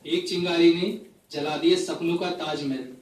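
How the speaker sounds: phrase-by-phrase mushaira delivery; poetry recitation. Acted. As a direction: recite each couplet to the audience with pauses. Recites a poem to audience. (मुशायरे के अंदाज़ में कविता पाठ)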